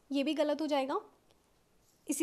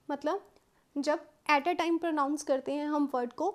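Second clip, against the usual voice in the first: ordinary talk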